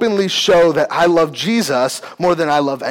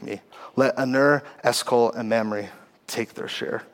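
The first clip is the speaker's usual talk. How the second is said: soft, measured Bible reading; quiet reading of Genesis; scripture reading; 5 semitones lower, 5 dB quieter than usual. Acted. Reads the Genesis passage aloud.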